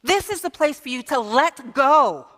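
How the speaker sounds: usual speaking voice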